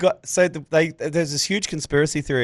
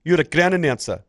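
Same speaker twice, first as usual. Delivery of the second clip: higher, insistent imitated South African telling-off; South African accent; 5 semitones higher than usual. Cricketer telling the announcer to do his job, South African accent. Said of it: "his South African accent that you always im".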